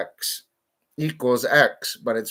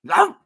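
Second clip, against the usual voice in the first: yappy chihuahua voice; 8 dB louder than usual. Retold or imitated.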